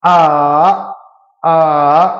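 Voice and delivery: आ dipping down then rising back up; dipping third tone. Shown as a demonstration. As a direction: curve आ down and up again. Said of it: "third curve tone".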